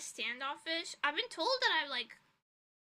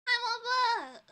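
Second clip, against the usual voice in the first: excited greeting; 10 semitones higher, 9 dB louder, slower than usual. Real, not acted. Greeting chat user by name.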